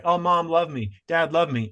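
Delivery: put-on high, pleading little child. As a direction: beg like a small kid for love.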